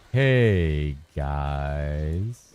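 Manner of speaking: low, falling youtuber greeting; Fubgun impression retry. Streamer retries Fubgun's intro, low and drawn out. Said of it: "The hey is wrong?".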